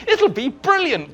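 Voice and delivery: high, slow, overbright reassurance; forced cheer. Retold or imitated. On the train, reassuring the Daleks' agent, forced bright cheer. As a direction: sell it with strained cheer.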